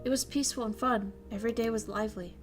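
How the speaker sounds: soft, quiet story narration; reading a visual novel aloud to chat. Acted. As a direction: read the scene gently. Reads visual novel scene.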